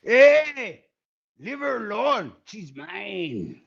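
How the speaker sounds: high, quick little chihuahua voice; chihuahua with an accent. Acted. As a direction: snap the punchline like a tiny cocky dog. Chihuahua punchline in the joke.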